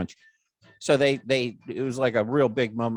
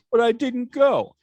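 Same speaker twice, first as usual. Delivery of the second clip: indignant denial; 12 semitones higher, 6 dB louder, slower than usual. Retold or imitated.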